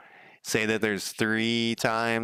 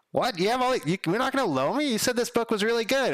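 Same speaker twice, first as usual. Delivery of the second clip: imitating a whiny, indignant friend; mock incredulous borrower; 12 semitones higher, faster than usual. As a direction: squeak it like a put-out friend denied a book. Mocking a borrower's whine.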